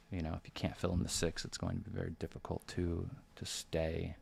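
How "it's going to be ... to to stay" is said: ordinary talk, nothing marked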